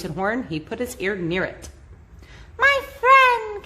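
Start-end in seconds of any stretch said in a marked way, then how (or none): 2.6-3.6 very high, small mayor's voice; high little voice from a speck; tiny Who voice. A narrator's reading. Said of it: "came the voice".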